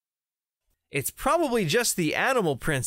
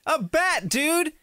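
ordinary delivery

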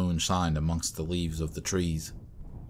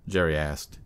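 ordinary delivery

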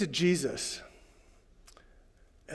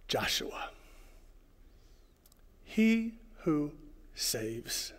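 whisper; 11 dB quieter, much slower than usual. Acted. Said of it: "whispered name".

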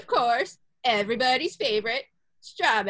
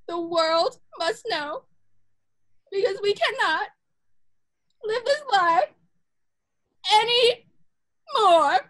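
rising higher and louder to a cry; dramatic build; 8 semitones higher, 3 dB louder than usual. Acted. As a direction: build the tension, then belt.

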